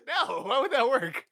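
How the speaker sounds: can't stop laughing while denying it; giggling denial between laughs. Real, not acted. Laughing at own story.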